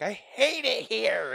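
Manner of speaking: high, loud whiny mock outburst; drawn-out, hammed-up tantrum cry; Jonah Hill. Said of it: "like, Jonah Hill being like".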